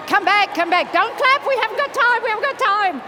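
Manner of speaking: loud, high-pitched hurry-up call; urgent calling out. Real, not acted. Coach stops the audience clapping for lack of time, urgent calling. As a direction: call over the applause to regain the room.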